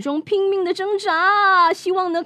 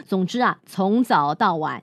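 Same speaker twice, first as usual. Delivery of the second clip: plain reading voice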